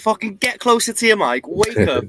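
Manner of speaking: loud, high exclamation; loud yell. Real, not acted. Friend yelling at his co-streamer to fix the mic. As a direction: burst out at a mate.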